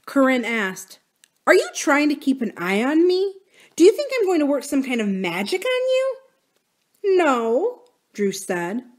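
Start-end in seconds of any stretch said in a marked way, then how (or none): 1.5-6.2 higher-pitched, rising accusatory questions; accusing challenge. Acted. Corinne challenges Drew for keeping watch on her, accusing. 7.2-7.8 higher, hesitant denial; unsure denial. A narrator's reading. Drew answers Corinne's accusation, unsure. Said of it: "she didn't sound too sure".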